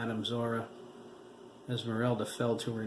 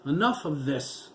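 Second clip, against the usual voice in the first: raised, louder call; 4 semitones higher, 5 dB louder than usual. A narrator's reading. Officer calling out to the hidden woman, loud. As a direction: cut them off sharply. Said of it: "he called".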